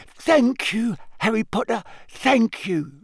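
high, broken-up grateful elf voice; breathless. A narrator's reading. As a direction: gasp out thanks between breaths. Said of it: "said Dobby breathlessly".

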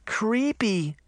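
put-on high-pitched little-girl exclamation; excited cry. A narrator's reading. Little sister reacts to the monster's name, high cry. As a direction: pipe up high in a child's voice. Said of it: "Daphne cried".

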